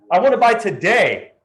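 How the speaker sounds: a keen client ready to buy; eager buyer. Acted. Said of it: "change their mind depending on their emotions".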